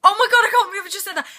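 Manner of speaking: high, loud, fast gasp of disbelief at her own slip; shocked squeal. Retold or imitated. To mum in the car after a slip, a loud shocked squeal. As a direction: squeal it out, horrified and laughing. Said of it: "And then I went".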